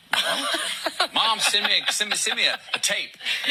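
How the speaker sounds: real laughter bubbling up mid-quote; amused laughing request to his mom. Real, not acted. Laughing while quoting himself.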